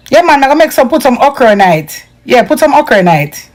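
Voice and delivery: casual patois chat in the kitchen; Jamaican patois. Shown as a demonstration. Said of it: "In Patois, we say okro".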